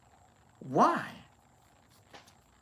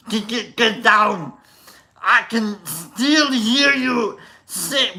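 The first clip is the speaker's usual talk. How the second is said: loud, higher Arnold Schwarzenegger singing; 5 semitones higher, 5 dB louder, slower than usual. Pretend. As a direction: sing it like Arnold, pumped up. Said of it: "He's Arnold".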